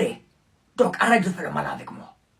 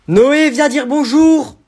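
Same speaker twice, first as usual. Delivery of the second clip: loud, high, slow call; aunt calling the boy to say hello; calling from another room; 9 semitones higher, 16 dB louder, much slower than usual. Acted. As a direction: call the boy from across the house. Aunt calls from afar.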